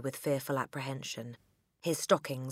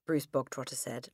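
plain reading voice

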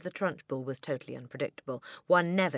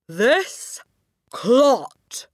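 high, very loud denunciation; booming; 10 semitones higher, 14 dB louder than usual. A narrator's reading. Headmistress denouncing a boy to the school, booming. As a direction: boom it out, each word a blow. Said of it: "boomed the headmistress".

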